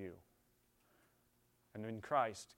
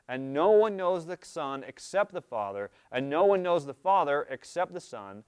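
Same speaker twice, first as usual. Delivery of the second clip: loud, slow, ringing scripture proclamation; full-force preaching; 4 semitones higher, 12 dB louder than usual. Acted. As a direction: declaim it to the whole church.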